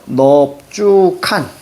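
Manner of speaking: 넙주 칸 drawn out syllable by syllable; slow pronunciation demo. Shown as a demonstration. Teacher repeats an important sound for students, slow drawn-out demo of 넙주 칸. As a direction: stretch each syllable of 넙주 칸 very slowly. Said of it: "중요하기 때문에 선생님이 계속 얘기하는".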